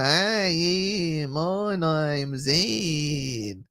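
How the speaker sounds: very slow, stretched North Queensland drawl; North Queensland accent. Acted. Mocking upper North Queensland folk, hugely drawn-out accent. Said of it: "upper North Queensland".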